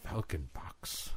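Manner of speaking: whispered, slow, under the breath; whispered echo. Real, not acted. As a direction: repeat it in a hushed whisper. Echoing the words back.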